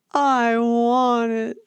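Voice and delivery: put-on slow, high, whiny child's demand; drawn-out whine. Parenting host mimicking a demanding child, slow drawn-out whine. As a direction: stretch it out like a nagging child. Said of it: "a bit of whining on your parenting journey. The drawn out".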